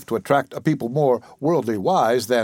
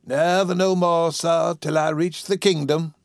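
high, slow, drawn-out invalid voice; weak invalid; 5 semitones higher, slower than usual. Acted. Sick woman awaiting the kingdom, weak and mournful. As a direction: lament slowly as an ailing old woman.

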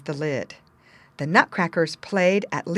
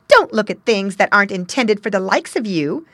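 sneering imp, much louder; giggly sneering imp; 3 semitones higher, 8 dB louder than usual. A narrator's reading. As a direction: warn him off with a sneer. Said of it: "said the imp".

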